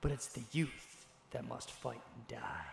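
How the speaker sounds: low, grave recitation, slowing to a hush; solemn quote reading. Acted. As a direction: read it like a weighty epigraph. Reads a war quote aloud.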